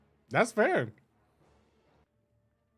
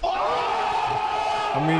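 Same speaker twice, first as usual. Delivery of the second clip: excited shout. Real, not acted. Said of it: "bursts out".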